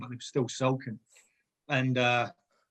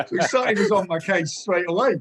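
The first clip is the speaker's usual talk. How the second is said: usual speaking voice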